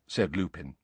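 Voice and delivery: usual speaking voice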